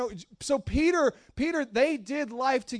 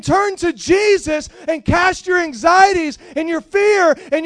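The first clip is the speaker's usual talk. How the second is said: loud, ringing pulpit shout; fiery sermon peak, shouting Peter's plea; full-force preaching; 5 semitones higher, 10 dB louder than usual. Acted. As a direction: preach it out to the back pews. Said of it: "I'm in the middle of preaching right now".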